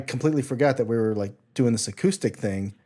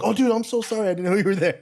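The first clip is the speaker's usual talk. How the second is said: higher-pitched, gushing apology; mock apology; 9 semitones higher, 3 dB louder, faster than usual. Playing musician apologizing to a head behind him, mock apology. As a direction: blurt out an acted, high-pitched sorry.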